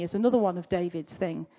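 ordinary talk, nothing marked

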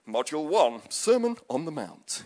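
measured educational tape voice; instruction tape narrator. Acted. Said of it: "imagine it as a series of tapes".

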